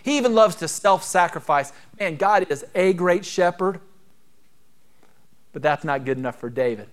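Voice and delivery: ordinary delivery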